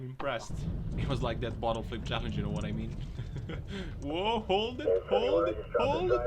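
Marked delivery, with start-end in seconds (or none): 4.0-6.2 high, loud shouts climbing higher; real high-pitched excited shouting; excited alarm. Real, not acted. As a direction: call out slowly, then rise to a yell. Reacting to truck about to tip.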